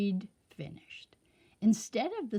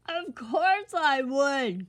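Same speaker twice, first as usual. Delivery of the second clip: slow, louder tearful cry; crying king; 5 semitones higher, 5 dB louder, slower than usual. A narrator's reading. The king crying out to Sunshine, slow tearful cry. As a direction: cry out in despair. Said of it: "cried the king".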